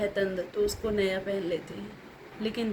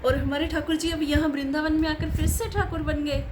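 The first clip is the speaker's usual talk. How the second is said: acted amazement in a higher pitch; amazed; 7 semitones higher than usual. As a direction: play the saint marvelling at a miracle, pitched up. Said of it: "मैं आश्चर्य हूँ इस बात से". (आश्चर्य का अभिनय, ऊँचे स्वर में)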